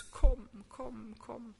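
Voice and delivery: loud longing call of kom, fading away. Acted. Preacher voicing the church crying out to Jesus, calling. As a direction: call out to Jesus, then let it die down.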